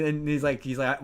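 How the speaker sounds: ordinary talk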